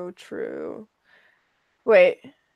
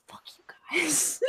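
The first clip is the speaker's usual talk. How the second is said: whisper; 4 semitones higher, 12 dB quieter than usual. Real, not acted. Joking aside to viewers.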